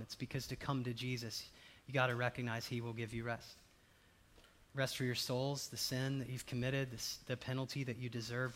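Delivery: ordinary delivery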